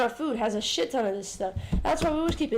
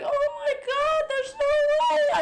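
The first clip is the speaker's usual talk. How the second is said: very high, loud, drawn-out squeal; mock squeaky sick voice, stretched out; kettle squeal; 14 semitones higher, 6 dB louder, slower than usual. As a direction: shriek it like a whistling kettle. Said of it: "I sound like a fucking kettle".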